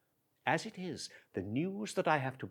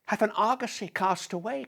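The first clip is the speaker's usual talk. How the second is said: ordinary talk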